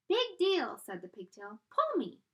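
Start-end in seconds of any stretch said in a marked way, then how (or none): 0.1-0.8 high, loud tiny voice; a brash little pigtail; high squeaky dismissal. Acted. Pigtail voice talking. 1.7-2.2 high, loud tiny voice; a brash little pigtail; high squeaky dismissal. Acted. Pigtail voice talking.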